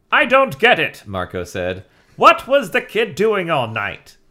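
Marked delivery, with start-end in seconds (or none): 0.1-1.0 put-on high, loud snarky teen; bright sarcastic kid; Marco voice. Marco's line read aloud. 2.2-4.2 put-on high, loud snarky teen; bright sarcastic kid; Marco voice. Marco's line read aloud.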